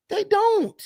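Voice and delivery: jumps high on They don't; high emphatic no. Real, not acted. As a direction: say it high and incredulous. Emphatic repeated denial.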